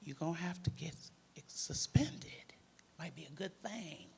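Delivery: hushed confiding whisper. Retold or imitated. Crisis worker confiding the plan to the girl in a whisper. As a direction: lean in and whisper it.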